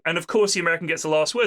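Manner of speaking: usual speaking voice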